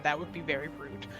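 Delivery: ordinary delivery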